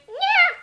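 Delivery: very high meow with a falling end; imitated cat meow. The cat complaining about flying underwear, high falling meow. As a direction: give the offended cat's meow. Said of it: "She went".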